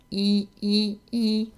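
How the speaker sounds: E with lips stretched wide at the corners; a tight smiling E; E vowel demo. Shown as a demonstration. Voice coach shows viewers a small-mouthed E vowel. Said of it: "see how the corners of my mouth".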